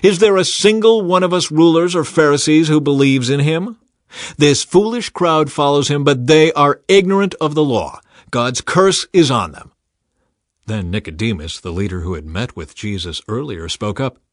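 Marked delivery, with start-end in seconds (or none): 0.0-9.7 louder, higher scornful rant. Acted. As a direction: look down on the crowd. Pharisees scolding and mocking guards.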